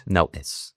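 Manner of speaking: "Notice" with a catch in place of the T; "Notice" as "No'ice", stop T; glottal stop T. Shown as a demonstration. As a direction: break "Notice" in two, no T. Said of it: "Ese sonido".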